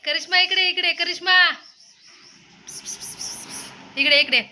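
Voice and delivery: coaxing a kitten by name; calling a kitten. Real, not acted. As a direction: call Karishma over, coaxing.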